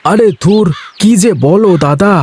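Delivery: put-on young villager shrugging off a teasing elder; laughing denial. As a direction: wave away the accusation, high and light. Ganesh brushes off accusation.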